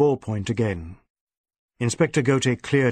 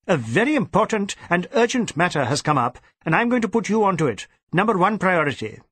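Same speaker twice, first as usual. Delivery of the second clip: urgent orders; 6 semitones higher than usual. Acted. DSP giving orders.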